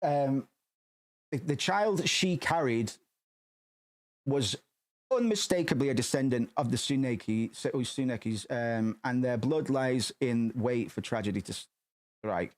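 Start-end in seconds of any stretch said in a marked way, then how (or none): 1.3-12.5 slow, ominous reading voice; dramatic reading. Acted. Reading a dark passage aloud to viewers, slow and ominous. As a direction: read it slowly, building dread.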